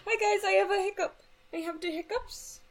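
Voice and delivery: high squeaky goofy voice; silly high voice. Pretend. Silly voice with hiccups.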